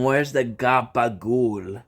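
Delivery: slow Italian-American gabagool; mock Italian accent. Shown as a demonstration. Telling a stranger where's the gabagool, in a mock Italian-American accent. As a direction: drawl gabagool like a mobster. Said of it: "You tell them".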